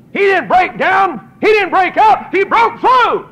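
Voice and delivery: loud, high, slow shout; preaching shout. Real, not acted. Preacher thundering at the congregation, loud and high. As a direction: hammer each phrase home.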